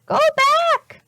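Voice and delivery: very high, loud parrot voice; squawking parrot. A narrator's reading. Polly the parrot warning the children, high squawk. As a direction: screech it like a parrot. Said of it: "she squawked".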